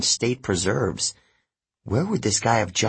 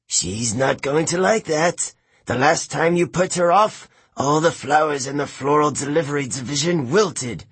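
higher-pitched, warning snake voice; female snake; 5 semitones higher than usual. A narrator's reading. Martha warns the jogger about putting Demeter off, higher snake voice. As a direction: warn him in a raised female snake voice.

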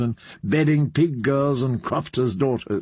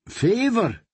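favour echoed much higher, amused and sly; mocking echo; 9 semitones higher than usual. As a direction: toss favour back with a mocking lilt. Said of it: "Qyburn chuckled".

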